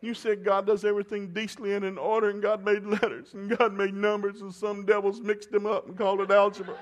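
high, loud whiny daughter voice; hammed-up teen arguing her case, pitched high. Daughter arguing with her dad over algebra, high and whiny. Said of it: "I said".

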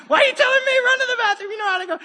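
a comic, incredulous dad, cracking up; dad incredulous his son asked permission, laughing it out; laughing exasperation. Retold or imitated. Dad exasperated at son's announcement.

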